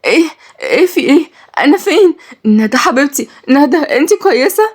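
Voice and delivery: high, panicked waking; terrified. A narrator's reading. Girl waking in terror calling for her sister. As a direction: gasp out in terror. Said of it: "بتقول برعب".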